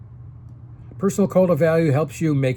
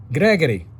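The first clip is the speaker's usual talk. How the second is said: a light imitation of an Italian grandfather's accent, higher and louder; 7 semitones higher, 4 dB louder than usual. Grandfather Giovanni advising young Gregory, in a light Italian accent. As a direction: lightly voice an old Italian man's accent giving advice. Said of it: "In his Italian accent".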